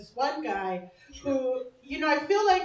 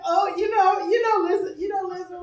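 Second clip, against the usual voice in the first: mock pushy fans; 6 semitones higher, 6 dB louder than usual. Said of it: "People are like".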